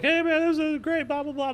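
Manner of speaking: high, bright hyped-up yell; hammed-up excitement; mock excited cheer. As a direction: gush like everyone's jacked up. Group excited after landing.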